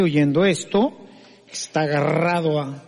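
usual speaking voice

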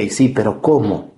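usual speaking voice

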